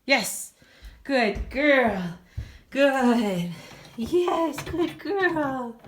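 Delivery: high, loud praise for a puppy; squeaky praising a dog. Real, not acted. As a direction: cheer the puppy on, bright and high. Praising puppy after quiet.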